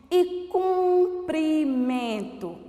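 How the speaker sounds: cumprimento drawn out slowly, stressing the U sound; teacher's slow model word; slow demo word. Shown as a demonstration. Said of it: "Cumprimento já é com U".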